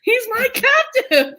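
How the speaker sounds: high, laughing mock cry of ownership; laughing mock shout. Reader laughing as Jude claims her captive, a high mock shout. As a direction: squeal it through a laugh. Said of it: "she's like".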